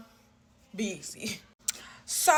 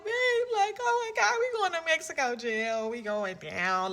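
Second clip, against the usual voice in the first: crying; 6 semitones higher, 3 dB quieter than usual. Retold or imitated. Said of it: "And I'm crying".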